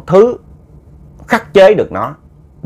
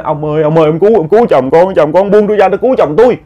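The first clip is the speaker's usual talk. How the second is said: desperate begging, a little higher; pleading wife; 4 semitones higher than usual. Retold or imitated. Wife begs the stranger to save her husband, pleading. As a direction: beg the old man to let you save your husband. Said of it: "van xin".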